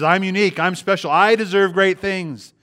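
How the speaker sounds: mocking self-important quote. Preacher mimicking a selfish believer, smug and entitled. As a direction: puff up like a spoiled man demanding blessings. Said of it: "He says".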